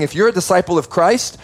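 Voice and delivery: normal talking voice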